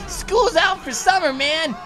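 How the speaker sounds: high, loud gleeful calling; rally the buses with glee; excited cheering. Real, not acted.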